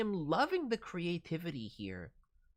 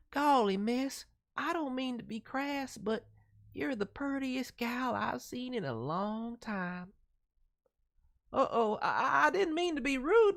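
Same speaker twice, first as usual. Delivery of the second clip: put-on higher, bashful country boy accent; 9 semitones higher, 5 dB louder than usual. Streamer voicing shy country boy Eddie with a country accent. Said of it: "soft-spoken, innocent country boy accent".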